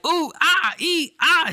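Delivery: hammed-up squeaky pained yelps; mock pain yelps. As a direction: yelp like a goofy hero getting punched. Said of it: "he's not like".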